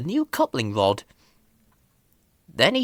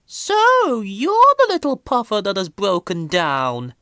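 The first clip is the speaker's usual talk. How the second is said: put-on high, condescending old lorry voice; haughty old steam lorry; Elizabeth; 8 semitones higher, 4 dB louder than usual. A narrator's reading. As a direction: look down on the little puffer. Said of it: "said Elizabeth to Thomas".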